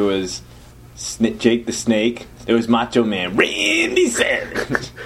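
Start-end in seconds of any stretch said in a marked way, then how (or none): 3.3-4.9 high, drawn-out wrestling holler; wrestler impression. Recalling eighties wrestling, co-host names Macho Man, high stretched wrestler voice. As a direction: stretch the name out high like a ring announcer. Wrestler name called out in character.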